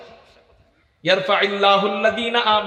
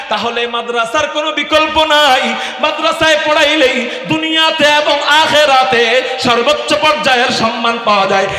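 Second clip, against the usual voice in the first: higher and much louder, ringing sermon voice; fiery preaching; 4 semitones higher, 6 dB louder than usual. Acted. Preacher urges parents toward madrasa, fiery preaching. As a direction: drive it home to the crowd.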